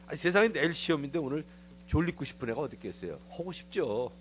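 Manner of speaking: ordinary talk, nothing marked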